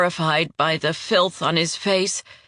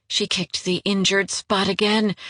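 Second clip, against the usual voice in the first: plain reading voice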